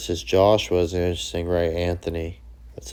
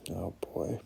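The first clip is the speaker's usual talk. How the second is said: whispered aside; 12 dB quieter than usual. Real, not acted. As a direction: murmur it to yourself, amused. Amused aside about point shaving.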